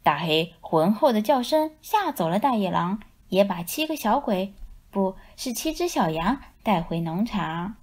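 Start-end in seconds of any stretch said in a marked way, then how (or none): none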